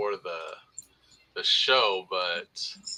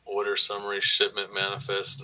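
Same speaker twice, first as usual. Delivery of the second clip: usual speaking voice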